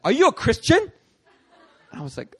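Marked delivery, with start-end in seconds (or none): 0.0-0.9 high, loud suspicious question. Retold or imitated. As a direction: call it out like catching someone out. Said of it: "She's like".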